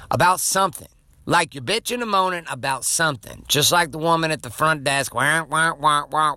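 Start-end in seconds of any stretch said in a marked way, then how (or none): 5.1-6.4 muffled trombone-like wah wah mimicry; Charlie Brown adult wah-wah. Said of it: "Charlie Brown's parents".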